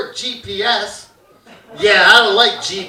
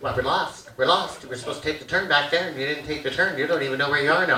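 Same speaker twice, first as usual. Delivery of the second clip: nagging mom; 7 semitones lower, 7 dB quieter, faster than usual. Retold or imitated.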